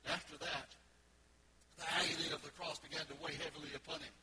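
ordinary talk, nothing marked

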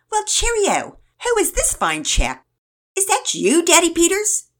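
high, loud mock-British greeting; British accent. A narrator's reading. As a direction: ham it up as a jolly British gent. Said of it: "to a British accent".

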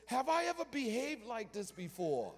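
high, hurt donkey voice; donkey pleading its good record; talking donkey. Acted. Donkey questions the prophet, high and hurt. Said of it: "the donkey says".

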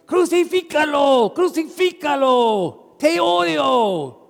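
high, loud hateful crowd yell; angry mob shout. Acted. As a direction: scream it like a mob demanding the crucifixion. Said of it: "tú estás gritando".

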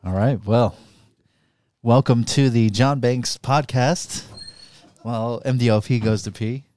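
earnest motivational podcast speech; podcast host voice. Pretend. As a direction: play a serious host giving a pep talk. Mock podcast pep talk.

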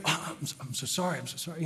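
soft, repeated mock apology; apologetic. British pastor apologizing to the American, quietly apologetic. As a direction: imitate a sheepish, softened sorry. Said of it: "he's very apologetic, very kind".

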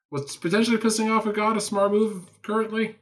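high, dry sarcastic question. Acted. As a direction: ask it with biting sarcasm.

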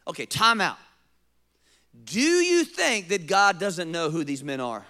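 ordinary talk, nothing marked

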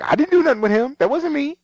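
high, indignant mock protest; defensive quote. Emily denying anything with men, high mock protest. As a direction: deny it in a squeaky rush.

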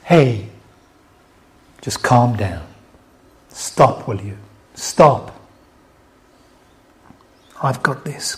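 low, soft, gentle telling-off; quiet, tender correction with pauses; loving rebuke. Acted. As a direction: gently check them, then reassure. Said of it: "lovingly rebuking us".

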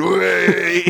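playful drawn-out whoop; hammed-up gleeful cry; mock cheer. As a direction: draw it out like a mock holiday cheer. Imagining sheriff cheering around Centre Parks.

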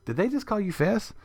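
lightly voiced curious buyer; asking Fess. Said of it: "he said".

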